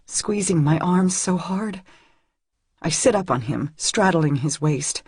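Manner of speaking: plain reading voice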